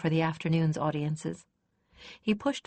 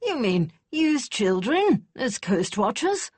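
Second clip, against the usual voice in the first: higher and louder, slow disbelief; exclaiming; 6 semitones higher, 5 dB louder, slower than usual. A narrator's reading. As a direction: ask it in slow astonishment. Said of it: "Garthazer exclaimed".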